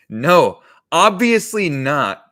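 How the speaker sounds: loud, high, drawn-out denial; mock exasperation. Customer answers the cashier's silly question, mock exasperation. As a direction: stretch it out, loudly stating the obvious.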